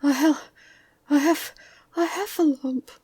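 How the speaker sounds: high, loud, broken stammer; breathless Piglet. A narrator's reading. Piglet telling Christopher Robin of the heffalump, breathless. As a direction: force it out in winded bursts. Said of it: "breathing so hard that he could hardly speak".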